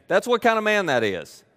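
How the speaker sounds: ordinary talk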